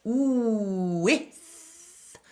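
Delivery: "Ooh, with" gliding from oo into the w; glide into with. Shown as a demonstration. Coach stretches the glide at the start of with. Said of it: "There's a glide at the beginning".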